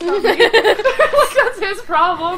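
high, loud laughing words. Real, not acted. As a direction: talk through a giggle fit. Laughing at the game.

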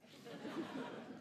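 high unvoiced burst of laughter; real amused laugh; breathy laugh. Real, not acted. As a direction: laugh at the absurdity of it. Director called test crap.

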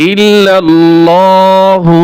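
ইল্লাল্লাহু drawn out slowly, each long vowel held; slow recitation. Shown as a demonstration. Teacher reciting the kalima slowly for students. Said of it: "এই হরফে চার আলিফ টান দিবেন".

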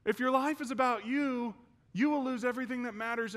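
high, pressing admonishment; father's warning. Retold or imitated. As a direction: warn your son with urgency. Dad's warning, acted out.

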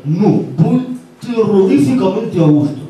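slow, melodramatic newsman's voice; Pedro Piqueras. Acted. Newsman passenger answers the taxi driver, slow dramatic Piqueras impression. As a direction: draw it out like a doom-laden anchor. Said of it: "Me para el taxi Pedro Piqueras".

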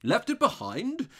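high, loud, slow repeat; dismayed surprise. A narrator's reading. Robber realises the parcel is gone, taken aback. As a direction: echo it in startled disbelief. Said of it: "another note in his voice besides his triumph".

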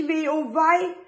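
lightly voiced sad mother hen sighing. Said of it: "Frau Huhn sagt traurig".

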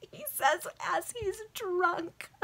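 words squeezed out through high laughter; real laughing. Real, not acted. Reader tickled by the drunk preacher, real laughing. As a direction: crack up while reading, voice climbing.

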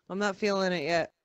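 normal talking voice

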